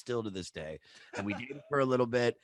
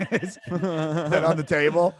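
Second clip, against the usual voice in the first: slow question through real laughter; laughing; 6 semitones higher, 6 dB louder, slower than usual. Real, not acted. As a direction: chuckle out the question. Laughing at friend's joke.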